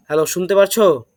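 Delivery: loud hello, checking they hear; to someone hard of hearing. Real, not acted. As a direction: call out to check the line. Checking whether class can hear. (জোরে ডেকে জিজ্ঞেস করা)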